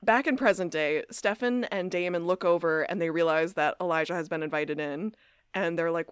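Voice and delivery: ordinary talk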